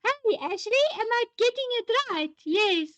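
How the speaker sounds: high-pitched, playful Afrikaans accent. Shown as a demonstration. Host asking a viewer if she's getting it right, high mock Afrikaans accent. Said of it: "talk in an Afrikaans accent".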